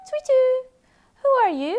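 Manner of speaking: high, loud owl voice; hooting owl. Acted. Owl greeting the three foxes, high and loud. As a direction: hoot the question like an owl. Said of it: "hooted the owl".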